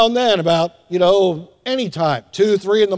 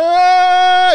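high imitated baby scream, louder; a hammed-up infant cry, loud and high; mock baby wail; 10 semitones higher, 5 dB louder than usual. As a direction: wail like a newborn at three in the morning. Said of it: "they are just crying".

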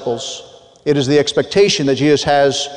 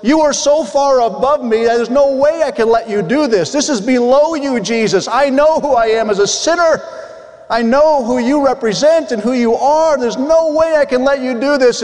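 higher, pleading voice of humbled Peter; 7 semitones higher than usual. Retold or imitated. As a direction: plead as the unworthy disciple. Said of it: "This was".

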